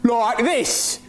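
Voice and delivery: high mocking Aussie voice; imitating Australian accent. Mocking the new guy's accent, high put-on Australian. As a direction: parrot his Australian accent back at him high. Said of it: "are you always going to sound like that?".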